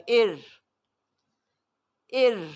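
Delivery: ار said light and thin; thin Quranic ار. Shown as a demonstration. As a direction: keep ار fine after zer. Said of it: "وہ باریک پڑھا جائے گا".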